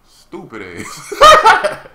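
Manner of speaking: slow words breaking into loud laughter; laughing insult. Real, not acted. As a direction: drag it out, then burst out laughing. Laughs at the failure.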